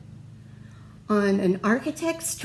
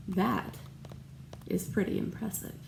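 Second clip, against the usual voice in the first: whisper; 3 semitones lower, 10 dB quieter, faster than usual. Real, not acted. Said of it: "aside to child".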